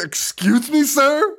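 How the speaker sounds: high-pitched, hammed-up disbelief; mock incredulous. Host mocking the men in the documentary, incredulous.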